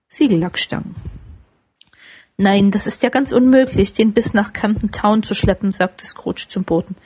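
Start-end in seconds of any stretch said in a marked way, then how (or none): none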